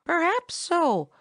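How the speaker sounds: high, slow and unsure; doubtful. A narrator's reading. Chatterer doubts Tommy's praise of the boy, doubtfully. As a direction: hold back, not convinced. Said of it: "replied Chatterer doubtfully".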